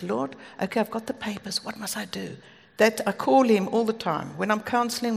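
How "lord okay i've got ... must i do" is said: softly retold private prayer, fading to a whisper; hushed prayer. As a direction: ask God under your breath. Retold private prayer before speaking.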